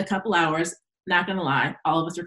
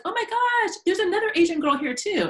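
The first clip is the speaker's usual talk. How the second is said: much higher squealing surprise; mock excited students; 13 semitones higher than usual.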